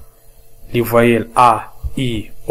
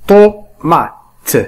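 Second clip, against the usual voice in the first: "Ordinateur", "Gâteau", "Tomate" louder, higher, broken into syllables; careful syllable demonstration; 7 semitones higher, 4 dB louder, much slower than usual. Shown as a demonstration. Teacher asks learners to listen closely, syllable by syllable. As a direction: punch out each syllable with gaps. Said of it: "استمعوا معي جيدا".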